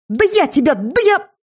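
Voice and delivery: very high, outraged sputter; sputtering threat. A narrator's reading. Seer threatening the shapeshifter, high outraged sputter. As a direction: climb in pitch as words fail you.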